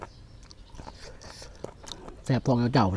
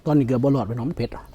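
ordinary delivery

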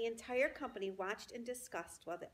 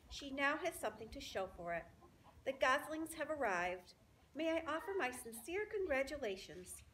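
stately little spider voice; gracious spider Charlotte; 6 semitones higher than usual. Acted. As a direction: begin a polite announcement. Charlotte makes the announcement.

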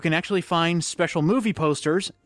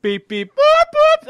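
car alarm beeps; 10 dB louder, slower than usual. Retold or imitated.

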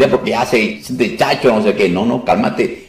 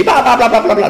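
mock babbling; 13 semitones higher than usual. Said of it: "a echar demonios así".

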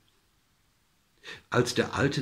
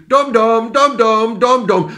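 loud, high taunting chant; mocking shouts; 10 semitones higher, 11 dB louder than usual. A narrator's reading. Village boys taunt the old man, mocking shouts. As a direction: call it out in mockery, much higher and louder. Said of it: "zum Spott riefen sie".

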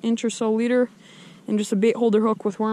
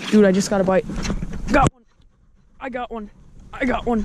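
excited. Real, not acted. Hooking a fish on the line.

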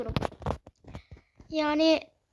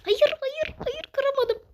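high, loud cry of frustration; frustrated yell; 12 semitones higher, 4 dB louder than usual. Real, not acted. Player yelling at the game when the break fails, high and loud. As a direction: wail at the game for failing.

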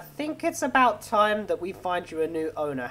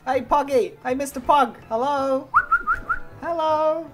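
calling a dog; 4 semitones higher than usual. Pretend. Calling to a little pug.

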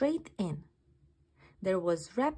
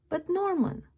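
puzzled snails; 8 semitones higher, 4 dB louder than usual. Acted. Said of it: "said the puzzled snails".

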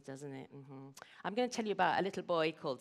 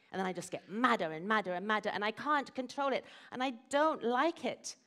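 building to higher, louder frustration; frustrated boy; 3 semitones higher, 4 dB louder than usual. Acted. Simon tells Mummy he can't control it, mounting frustration. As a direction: let a child's exasperation mount.

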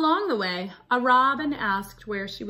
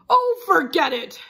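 louder, higher exasperated outburst; wolf losing patience; 7 semitones higher, 8 dB louder than usual. Acted. Wolf giving up on Red, loud exasperated outburst. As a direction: burst out fed up before pouncing.

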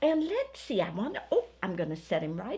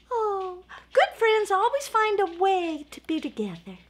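lightly voiced snowman, higher and gentle; Sparkly the snowman; 9 semitones higher, 6 dB louder than usual. Sparkly comforts Little Bear.

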